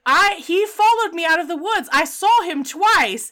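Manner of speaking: high-pitched, insistent retelling; retold indignant protest. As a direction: act out her exasperated protest at not being believed. Retells woman insisting she was stalked.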